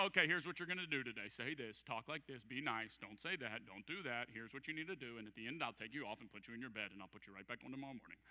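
low, quiet, hurried run of orders; puppet master. Pretend. Preacher mimicking someone working a puppet, low hurried mock orders. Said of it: "put you on like a puppet and go".

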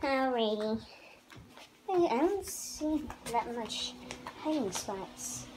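usual speaking voice

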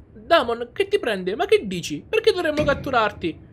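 high, loud and alarmed; alarmed character voice. Acted. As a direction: call out, worried and confused. Hero reacts to strange voice.

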